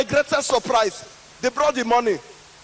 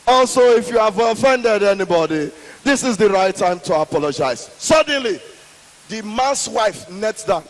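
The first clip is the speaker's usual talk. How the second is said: usual speaking voice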